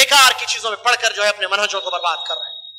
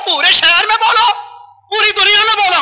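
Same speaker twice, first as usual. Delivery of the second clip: defiant shout; 8 semitones higher, 5 dB louder than usual. Real, not acted. Preacher daring critics to spread it, loud defiant shout. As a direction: throw the dare out to the whole city.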